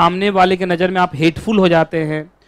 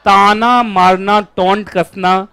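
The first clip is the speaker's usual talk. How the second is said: slow emphasis; slower than usual. Shown as a demonstration. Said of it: "क्या बोलते हो taunt कसने को?".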